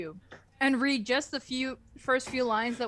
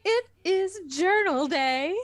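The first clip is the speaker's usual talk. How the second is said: higher, slow, prim schoolteacher voice; prim teacher; 8 semitones higher, 5 dB louder, slower than usual. Acted. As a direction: announce it like a stiff English teacher. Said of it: "you're Missus Lesson".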